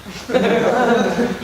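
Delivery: a bright burst of laughter. Real, not acted. Rabbi laughing at the man's answer, a real burst of laughter.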